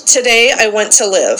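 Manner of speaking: loud call-and-response recitation, each line declared for the group to repeat; loud group-leader recitation; leading a creed. Acted. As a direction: proclaim it to the room like a rallying creed. Leads daily creed recitation.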